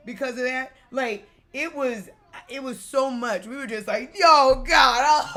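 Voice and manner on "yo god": loud, high yelling "Yo, God"; ride scream. Retold or imitated. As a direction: yell it like the coaster just dropped.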